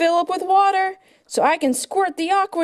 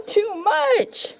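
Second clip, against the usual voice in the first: usual speaking voice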